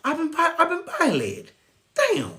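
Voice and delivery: high, hammed-up wounded whimper; voice of anger. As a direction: play your hurt anger as a mock crying child. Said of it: "it tells you".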